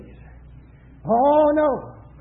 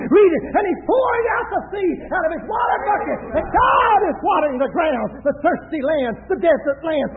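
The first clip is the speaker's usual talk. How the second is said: raised, shouted sermon delivery; full-force preaching; 2 semitones higher, 5 dB louder than usual. Acted. Preacher pictures Israel's tents for the congregation, full-force preaching. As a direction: preach it out with long, cried-out openings.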